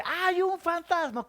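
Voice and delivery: high, shouted ghost alarm; a man yelling in fright; frightened shout. Shown as a demonstration. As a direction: cry out that you see a ghost. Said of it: "quiero escuchar a un hombre decir".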